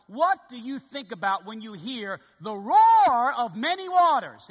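asking the church to picture the roar; rising appeal. Real, not acted. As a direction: swell toward the end. Rising, loud rhetorical question.

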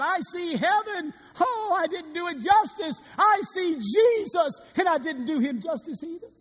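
high, loud rapturous shout; ecstatic cry. Acted. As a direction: cry out in awe at heaven. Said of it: "that great Baptist preacher, said".

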